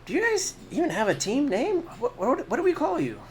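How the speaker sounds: high, bright barmaid voice; curious barmaid asking the party. Acted. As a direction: barmaid asks the heroes their team name. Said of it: "she's like".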